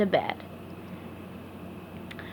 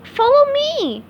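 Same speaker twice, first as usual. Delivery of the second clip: very high, slow cheerful call; eager call; 5 dB louder, slower than usual. Acted. SpongeBob calls Patrick out to play, high cheerful. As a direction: beckon a buddy with glee.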